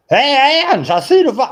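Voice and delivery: high, loud, wound-up nervous deputy voice; high excited deputy calling out; Barney Fife impression. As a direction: squeak it out like a jittery small-town deputy. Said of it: "I do my Don Knotts impression".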